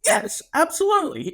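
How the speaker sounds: high, eager yes yes; mock delighted customer. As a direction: gush like a thrilled kid. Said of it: "he was like".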